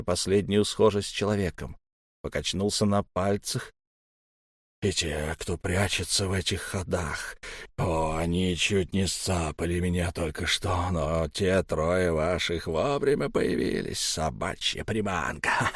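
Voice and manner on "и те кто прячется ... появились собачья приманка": frantic raving. Acted. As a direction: rave it like a madman. Elm raves about lurking creatures.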